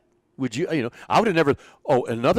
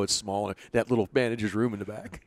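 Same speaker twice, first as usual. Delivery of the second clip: normal talking voice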